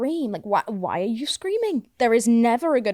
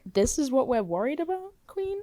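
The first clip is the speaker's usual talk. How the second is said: mock disbelief; 4 semitones higher, 4 dB quieter than usual. Out-of-body self mocking own worries.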